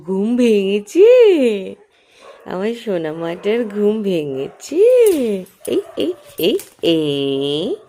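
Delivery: slow, drawn-out cooing to an infant; baby talk. Acted. Mother cooing to her baby as she wakes up. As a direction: coo at the waking baby, stretching the words.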